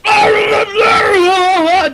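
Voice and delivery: high, drawn-out slow mock shout; stretched, crying-out mock shout; imitated yell from a car. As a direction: yell it like a lout driving past. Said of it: "This guy goes".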